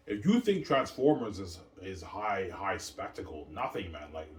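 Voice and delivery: ordinary talk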